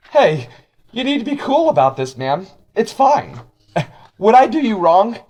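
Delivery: very high, loud silly character voice; stupid voice. Acted. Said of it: "that stupid voice".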